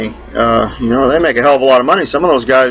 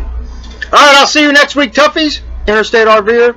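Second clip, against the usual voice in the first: higher, upbeat host outro; raised, cheery podcast sign-off; show sign-off; 8 semitones higher than usual. Acted. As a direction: wrap up the show like a radio host.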